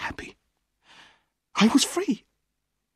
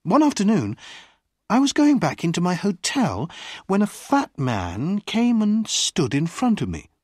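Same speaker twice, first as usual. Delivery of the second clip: ordinary delivery, nothing marked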